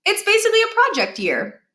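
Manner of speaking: put-on a boy insisting on his plan; cheeky young Miles. A narrator's reading. Miles arguing with mom.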